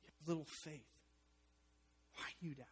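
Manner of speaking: soft, tender, quiet rebuke; quiet and kind, no scolding; gentle reassurance. Retold or imitated. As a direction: say it softly like a loving father. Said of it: "He says".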